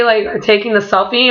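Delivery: ordinary talk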